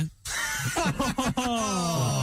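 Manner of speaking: a short high burst of laughter. Real, not acted. Comedian laughing at his friend's rude reply, high laugh.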